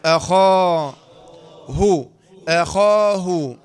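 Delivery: أخاه said slowly and loudly with a clear final ه; careful pronunciation demo. Shown as a demonstration. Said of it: "لاحظ أنا قلت أخاه". (نطق أخاه بوضوح مع إظهار الهاء)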